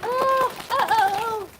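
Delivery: high, loud string of oh's; startled cry. Real, not acted. Startled by what just happened, high loud cry. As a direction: yelp out a run of high oh's. Reacting to something with the animals.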